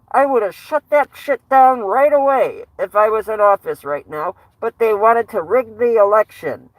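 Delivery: amateur impersonation of an aggrieved president; Donald Trump. Acted. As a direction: deliver it as a boastful president's stump speech. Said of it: "I can't do a good Trump impression".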